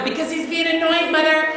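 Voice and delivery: high, mock-exasperated excuse; put-on sibling indignation; indignant kid. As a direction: protest to mom like a wronged kid. Acts out older sibling answering mom.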